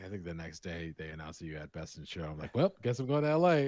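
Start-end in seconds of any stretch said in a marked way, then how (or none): 2.6-3.7 louder, higher, mock-casual excitement; retold excited announcement. Friend reacting to the Best in Show news, excited. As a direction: announce the trip with a raised, punchy voice.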